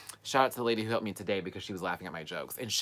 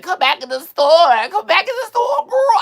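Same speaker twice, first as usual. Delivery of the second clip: over-the-top shouting; 14 dB louder than usual. Retold or imitated. Daring the driver to come back, hammed-up shouting. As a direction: scream it theatrically, doing the most. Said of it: "I was just doing the most".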